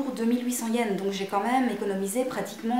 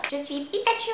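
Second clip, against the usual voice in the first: Pikachu voice; 5 semitones higher than usual. Pretend. Playing a Pikachu mask.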